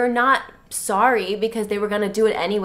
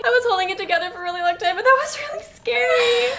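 crying; 8 semitones higher, faster than usual. Pretend. Mock hysterical crying.

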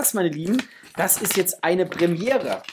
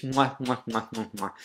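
blown kisses; 4 semitones lower, 5 dB quieter than usual. Real, not acted. Blowing kisses to viewers.

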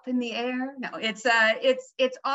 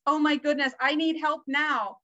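higher, louder pleading like a panicked customer; mock panic; 7 semitones higher, 5 dB louder than usual. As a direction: act out an urgent call for help. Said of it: "calls you up in a panic".

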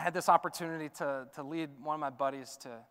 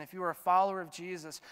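ordinary talk

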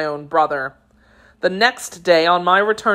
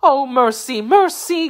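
high, pleading wail, climbing higher; miserable cry; 8 semitones higher than usual. A narrator's reading. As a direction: beg desperately for your life. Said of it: "cried the miserable woman".

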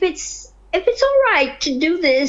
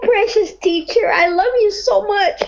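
tearful; 6 semitones higher than usual. Real, not acted. Emotional caller thanking sheikh.

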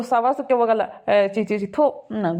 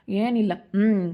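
ordinary talk

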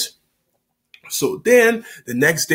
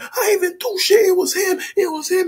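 squealing innocent brother; high whiny protest. Retold or imitated. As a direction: whine it out high. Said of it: "my brother like".

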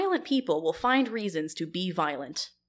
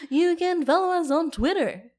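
higher and louder put-on promo voice; hammed-up announcer voice, higher and louder; silly plug voice; 9 semitones higher, 6 dB louder than usual. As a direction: belt the plug like an over-the-top host. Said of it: "Why'd you stop doing the voice?".

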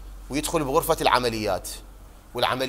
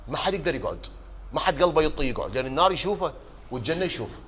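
ordinary talk

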